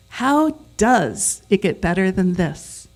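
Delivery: "does" punched loud and drawn out; slow sentence leaning hard on "does"; stress on does. Shown as a demonstration. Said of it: "where you emphasize each word in a sentence".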